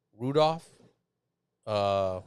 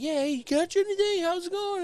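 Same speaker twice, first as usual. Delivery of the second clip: high, loud hailing from afar; mock hollering; 6 dB louder than usual. Stranger hailing a fisherman, mock hollering. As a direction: imitate an over-eager stranger hollering hello. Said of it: "people yelling and hollering at me".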